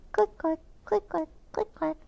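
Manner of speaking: high, soft clicky shoe sounds; light high footstep noise; click clack of heels. Acted. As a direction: tap out the heels with your voice. Shoes walking.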